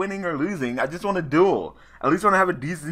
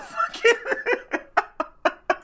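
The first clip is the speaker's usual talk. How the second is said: high, airy cackling laugh; high cackle. Real, not acted. Gamer cackling after his opponent quits, high airy laugh. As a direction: burst into a squeaky giggle.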